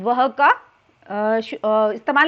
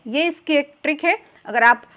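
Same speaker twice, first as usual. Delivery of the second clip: ordinary delivery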